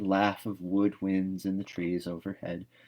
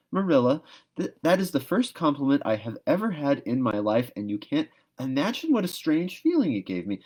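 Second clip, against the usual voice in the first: put-on higher, louder, breathless girlish gush; excited gushing; 6 semitones higher, 3 dB louder than usual. A narrator's reading. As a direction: gush about the compliment, rising. Anne gushing about compliment.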